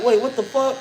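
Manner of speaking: high-pitched mock disbelief; retold bewilderment. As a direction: act out being baffled. Retold discovery of the missing card.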